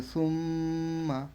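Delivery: "সুম্মা" with a long nasal m; slow, held m in "সুম্মা"; ghunnah. Shown as a demonstration. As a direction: hum on the m, then release "মা" in "সুম্মা". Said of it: "এটা গুণনা করব".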